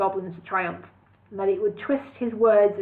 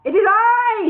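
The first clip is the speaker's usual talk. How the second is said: high, loud proclamation; announced; 12 semitones higher, 8 dB louder than usual. A narrator's reading. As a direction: step forward and declare it. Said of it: "he announced".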